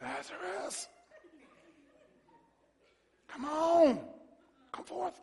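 high, soft, tentative coaxing; mock timid call. Preacher mocking a timid call to Lazarus, hesitant. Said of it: "He didn't do that".

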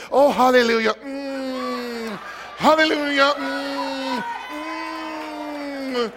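mock dainty hums and hallelujahs; prissy worshipper. Pastor mocking a prim worshipper with dainty hums. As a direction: ham up a too-pretty worshipper. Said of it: "you look like a pterodactyl".

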